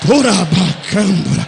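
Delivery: low, slow, loud tongues; loud ecstatic glossolalia in the pulpit; speaking in tongues. Acted. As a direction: let the tongues roll out slow.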